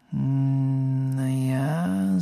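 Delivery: drawn-out, pondering hum; slow, hesitant. A narrator's reading. Pavati considers who might go with Ron, slowly. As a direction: stretch each sound while thinking it over. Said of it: "sagte Pavati langsam".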